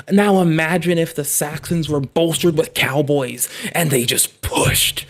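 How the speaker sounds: loud, building excitement; pushing the idea hard; excited. Real, not acted. Excited rant about cowboys.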